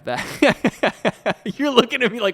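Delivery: high, loud, drawn out through laughter; laughing disbelief. Retold or imitated. Teasing the co-host about his stare, laughing loud disbelief. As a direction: crack up while voicing someone's bafflement. Mimics co-host's baffled look, laughing.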